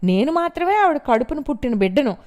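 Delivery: high, loud furious outburst; lashing out. A narrator's reading. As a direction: tear into her mother-in-law. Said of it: "ఆవిడ మీద విరుచుకుపడింది".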